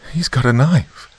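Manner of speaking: high, loud cry of alarm; alarmed scream. A narrator's reading. Mother spots the knife in son's hand, alarmed cry. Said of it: "Sheila screamed".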